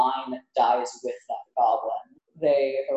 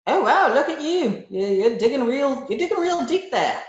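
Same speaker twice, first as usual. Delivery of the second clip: goblin voice; 8 semitones higher than usual. Acted.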